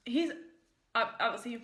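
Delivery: nearly choking on food; words caught on a bite. Real, not acted. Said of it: "excuse me, I almost choked".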